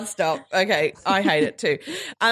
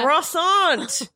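higher, exaggerated French croissant; French accent; 6 semitones higher than usual. Shown as a demonstration. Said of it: "listen to my French accent".

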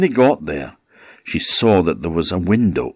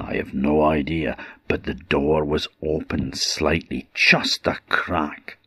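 hushed suspense; 3 semitones lower than usual. A narrator's reading. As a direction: lean in, the trouble starts now. Suspense drop before the mishap.

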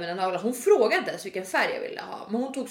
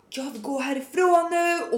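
higher, slow drawn-out mock yell; mock screaming; 5 semitones higher, slower than usual. Customer demanding to leave, mock screaming. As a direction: wail it like a fed-up customer. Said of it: "så hon fortsätter skrika".

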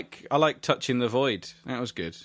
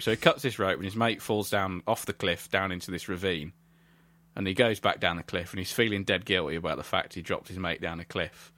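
ordinary talk

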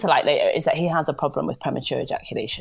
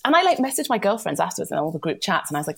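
ordinary talk, nothing marked

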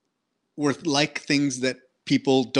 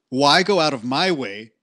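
normal talking voice

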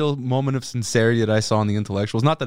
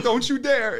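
mock warning; 11 semitones higher, slower than usual. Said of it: "the way you were like".

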